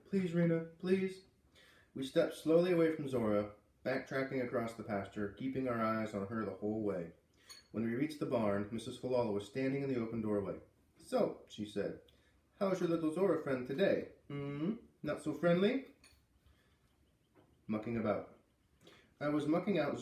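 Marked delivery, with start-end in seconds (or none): 0.1-1.2 high, pleading plea, louder on the second please. Acted. Scared little brother begging his sister to go, high pleading. 11.0-15.9 high, knowing teasing lilt. Acted. Old farm woman teasing the kids about their cow, sly lilt. Mrs Falala teasing children.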